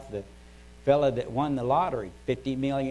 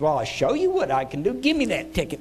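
angry threat; 6 semitones higher, 5 dB louder than usual. Acted. Winner threatens clerk.

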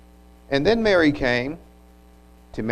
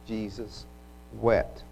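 much softer, nearly breathed "Jesus"; hushed reverence; 8 dB quieter, slower than usual. Acted. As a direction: let the name fall away to almost nothing. The shortest words in Scripture.

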